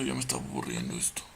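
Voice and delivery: fast, soft whisper. Real, not acted. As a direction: whisper it as an aside. Bored aside whispered.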